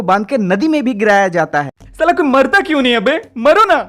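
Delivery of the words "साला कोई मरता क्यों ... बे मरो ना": exasperated. Real, not acted. Ranting about characters never dying.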